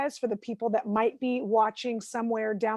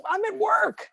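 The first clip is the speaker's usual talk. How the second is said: protesting; 9 semitones higher than usual. Retold or imitated. Husband's excuse in retold quarrel.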